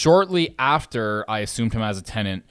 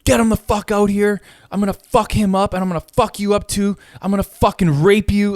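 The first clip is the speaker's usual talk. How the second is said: much higher, louder screaming threats; imitated raging shout; 9 semitones higher, 6 dB louder than usual. Retelling the neighbour's switch to rage, imitated screaming threats. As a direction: scream the threats like a man losing it. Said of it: "switch and be like".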